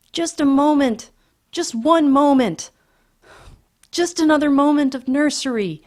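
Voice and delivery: high, loud pleading wail; wailing children. A narrator's reading. Children beg their father for the nursery, wailing. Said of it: "they wailed".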